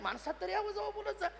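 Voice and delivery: soft, high-pitched private plea; small, discreet voice; hushed aside. Acted. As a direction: murmur it high, for one person's ears. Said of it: "हळूच नामदेवाला सांगितलं".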